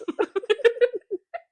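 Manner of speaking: high, loud burst of laughing; laughter. Real, not acted. Host amused by the imagined fathers' argument, laughing. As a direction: crack up at your own joke. Laughing at own acted bit.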